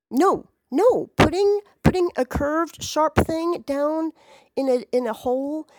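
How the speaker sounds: squealing in horror; high, loud, cringing no; horrified crying out. Real, not acted. Horrified at syringe idea.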